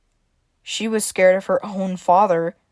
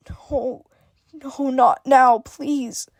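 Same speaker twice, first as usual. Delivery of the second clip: whimpering; 5 semitones higher, much slower than usual. A narrator's reading. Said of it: "Evelyn whimpered".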